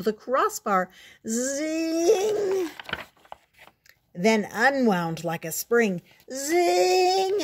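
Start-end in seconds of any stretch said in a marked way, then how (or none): 1.3-2.7 high, long ringing zing; sound-effect zing. A narrator's reading. Narrator as Froggy winds round the crossbar, high zing. 6.3-7.4 very high, loud, long zing; sound-effect zing. A narrator's reading. Narrator as the swing unwinds, ringing zing.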